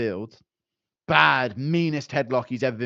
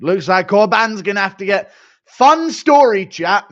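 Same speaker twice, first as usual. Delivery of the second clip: higher, louder excited burst; high loud excited story tease; 7 semitones higher, 9 dB louder than usual. Real, not acted. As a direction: burst into hype for a fun story. Sudden hype storytelling.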